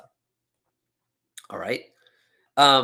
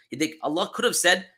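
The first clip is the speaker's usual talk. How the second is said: ordinary talk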